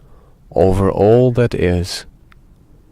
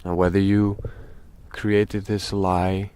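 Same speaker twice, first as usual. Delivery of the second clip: slow meditation talk with very long pauses; speaking slowly; 5 dB quieter than usual. Real, not acted. Guide tells dear friends about guilt, slow and meditative. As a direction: let each phrase hang in silence before the next. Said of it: "we are speaking rather slowly".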